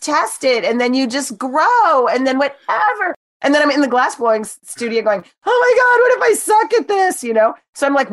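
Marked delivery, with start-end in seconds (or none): 5.5-7.2 high, loud panicked squeal; mock panic. In the glass studio, fearing she'll fail, panicked squeal.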